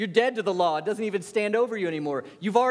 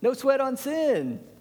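careless mock voice; 8 semitones higher, slower than usual. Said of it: "They're like".

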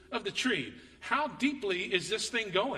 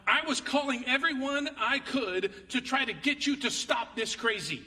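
higher and louder, exasperated; retold frantic quote; 7 semitones higher, 5 dB louder than usual. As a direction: repeat her complaint with mock alarm. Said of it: "this is the quote".

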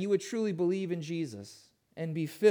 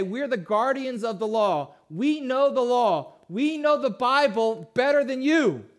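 louder, higher, haughty; smug, puffed-up superiority; condescending Pharisees; 5 semitones higher, 6 dB louder than usual. Acted. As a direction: look down on the crowd from the pulpit. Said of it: "in their condescension".

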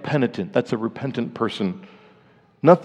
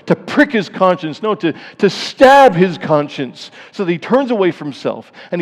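louder fervent pulpit preaching, rising in pitch; preaching at full force; 5 semitones higher, 6 dB louder than usual. Acted. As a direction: drive the mercy home to the congregation. Preaching on the rooster.

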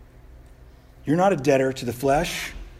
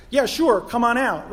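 higher, louder, breezy and easy; casual Jesus; 8 semitones higher, 4 dB louder than usual. Retold or imitated. Jesus calling Peter out onto the water, breezy and casual. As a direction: toss the invitation off lightly. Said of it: "Jesus is like".

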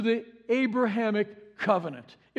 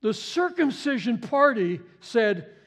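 ordinary delivery

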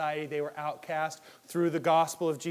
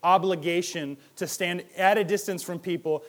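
normal talking voice

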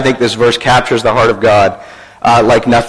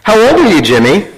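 lightly acted higher-pitched caller; telemarketer; 6 semitones higher than usual. Telemarketer questioning a small boy, lightly acted higher voice. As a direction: coax a small child with a question. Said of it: "A telemarketer".

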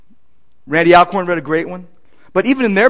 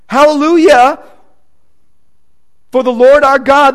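loud, higher reading of Revelation; fervent scripture reading; 4 semitones higher, 4 dB louder than usual. Acted. Preacher reading the marriage of the Lamb aloud to the church, loud and fervent. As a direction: proclaim the wedding of the Lamb.

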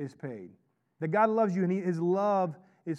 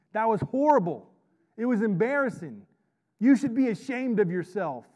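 loud, higher-pitched shaming rant; mock scolding; 4 semitones higher, 7 dB louder than usual. Father shaming his kids over saved diapers, loud mock scolding. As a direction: play the outraged parent, hammering each phrase.

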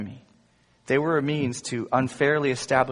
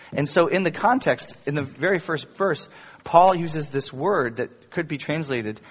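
ordinary delivery

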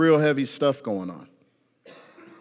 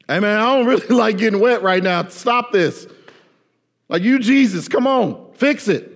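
high, loud, chummy grumbling; mock casual complaint; 7 semitones higher, 4 dB louder than usual. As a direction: whine to Jesus like a buddy.

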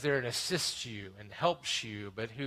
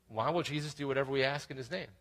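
usual speaking voice

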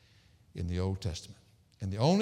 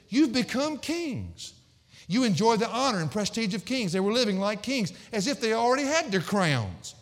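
high, forceful preaching, building louder; scornful indictment; 11 semitones higher, 5 dB louder than usual. Acted. Preacher voicing Paul to the proud Corinthians, scornful indictment. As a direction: hurl each challenge at the congregation from a raised pitch.

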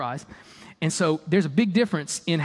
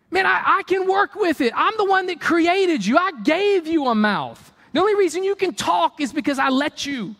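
exasperated mock God; 10 semitones higher than usual. Preacher as God scolding Moses about the bush, exasperated mock rant. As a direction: berate him in comic disbelief.

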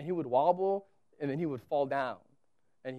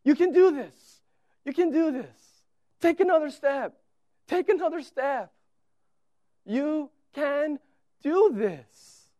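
much higher, louder repeated urging; cheering dad; 10 semitones higher, 6 dB louder than usual. Retold or imitated. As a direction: coach the fallen toddler back up. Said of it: "I say".

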